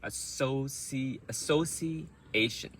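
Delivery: association slowly, broken after associ; rhythm demo. Shown as a demonstration. Teacher shows rhythm of association, slow and broken up. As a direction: draw out association piece by piece, stressing the syllables. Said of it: "So the rhythm as well".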